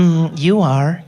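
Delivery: slow, sultry purr; seductive Siri. Retold or imitated. Siri flirting back when asked who's your daddy, slow sultry purr. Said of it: "She's got that vibe to her".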